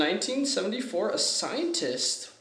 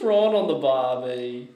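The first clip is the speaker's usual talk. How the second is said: mock Australian, drawn vowels; Aussie accent; 3 semitones lower, 6 dB louder than usual. Correcting the co-host's shrimp line, lightly mock Aussie accent. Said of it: "I had an Aussie accent".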